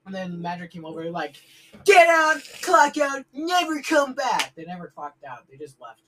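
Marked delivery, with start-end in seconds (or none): 1.8-4.5 loud, high shouted dismissal; shouted commands, slowing on the last one; retold angry order. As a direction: imitate a manager throwing staff out. Retold manager throwing workers out.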